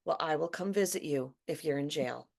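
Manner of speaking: a mother's calm promise to her worried son. Retold or imitated. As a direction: soothe him without any alarm. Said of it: "the mom just very calmly said".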